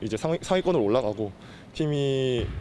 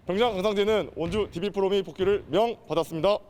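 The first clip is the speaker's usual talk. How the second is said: crisp formal military report, pitched up; korean military style; 6 semitones higher than usual. Real, not acted. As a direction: report in like a sergeant to his officer. Soldier formally reports return to duty.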